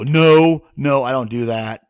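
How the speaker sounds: loud, drawn-out "no"; big-deal scolding. Retold or imitated. Imitating people calling dogs off poison birds, loud scold. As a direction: mimic handlers making a big deal of it. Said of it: "I don't get in a big deal".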